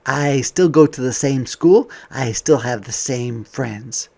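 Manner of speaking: bright, lively picture-book voice; reading to children. Acted. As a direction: read it out to a class of kids.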